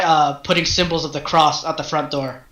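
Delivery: ordinary talk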